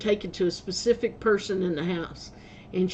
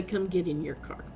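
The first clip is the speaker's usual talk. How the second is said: lightly voiced dog, low and much softer; the dog quietly ordering the man into his car; dog's voice; 4 semitones lower, 9 dB quieter than usual. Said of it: "he'd jump in your car and say".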